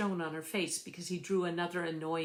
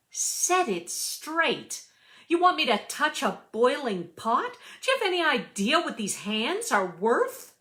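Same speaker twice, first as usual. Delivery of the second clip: furious scolding; 7 semitones higher, 5 dB louder than usual. Acted. Tricia scolding the chef over the boiling pot, furious. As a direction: snap at him as if ready to wring his neck.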